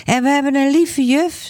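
put-on high little boy's voice; happy child. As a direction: say it sweetly like a small boy. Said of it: "zegt Gijsbert". (hoge, blije kinderstem)

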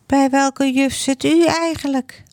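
put-on high little boy's voice, innocent question; curious child. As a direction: ask dad innocently like a small boy. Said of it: "vraagt Gijsbert opeens". (hoge kinderstem, onschuldig vragend)

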